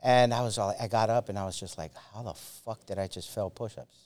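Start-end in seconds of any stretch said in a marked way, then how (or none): 2.1-4.0 stunned mutter. Retold or imitated. Retold disbelief after failing test.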